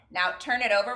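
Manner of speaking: normal talking voice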